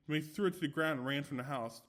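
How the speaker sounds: plain reading voice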